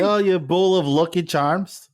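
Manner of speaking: mock Irish taunt at a friend. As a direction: ham up an Irish insult, lightly. Mock Irish insult in banter.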